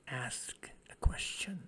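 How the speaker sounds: whispered, slow. Real, not acted. Teacher softly repeats the meaning.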